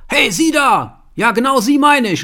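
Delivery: yelling at a stranger in a pharmacy; angry shout. Retold or imitated. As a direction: shout it high and loud across the room. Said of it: "die Tür auf und schreit".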